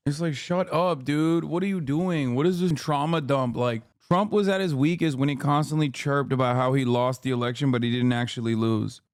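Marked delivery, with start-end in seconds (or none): none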